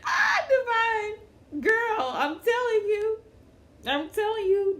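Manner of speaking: high, slow laughing wail; laughing cry out. Real, not acted. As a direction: cry her name out through laughter.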